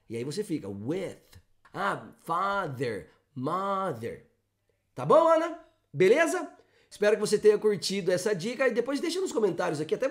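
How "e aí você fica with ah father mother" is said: "with, father, mother" said slowly with a clear TH; slow model words for practice; careful th words. Shown as a demonstration. Teacher models more TH words for practice. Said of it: "Faz você direitinho".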